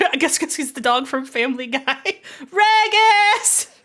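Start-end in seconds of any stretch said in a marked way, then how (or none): 2.4-3.8 high, drawn-out yell; Kobo. Acted. Player imitating the little sister calling her brother, yelling. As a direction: holler the name at full volume, much higher. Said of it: "just yell and call him Regis".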